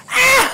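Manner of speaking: a yelp rolling into laughter; shouting laugh. Real, not acted. Gamer laughing at the game, yelping laugh.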